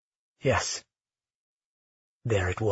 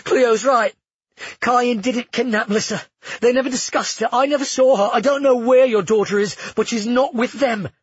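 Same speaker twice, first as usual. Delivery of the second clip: put-on urgent young man; 9 semitones higher, 5 dB louder than usual. A narrator's reading. Nick voiced, urgent.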